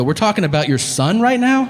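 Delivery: high-pitched disbelief, rising at the end; incredulous question. Retold or imitated. Caller to father insulting his son, incredulous high question.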